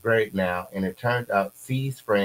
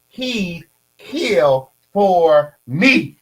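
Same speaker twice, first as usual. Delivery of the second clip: very slow, drawn-out and higher; reading a message aloud; 8 semitones higher, 8 dB louder, much slower than usual. Retold or imitated. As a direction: stretch it out loud and high as a mocking read. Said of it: "Here go the messages".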